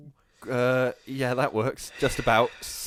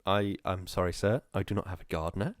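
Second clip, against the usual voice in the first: film quote voice; 8 semitones lower, 5 dB quieter than usual. Acted. To his co-host, quoting a film, a mild humble poor voice. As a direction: play it meek and lowly, hinting at a film character. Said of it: "I think that's a quote from the film".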